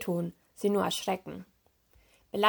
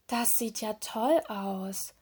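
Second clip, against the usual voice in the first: slow, hushed wonder; amazed; 3 dB quieter, slower than usual. A narrator's reading. Little mermaid seeing the beach, in slow amazement. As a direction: marvel at it, drawing the words out. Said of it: "staunte das kleine Meermädchen".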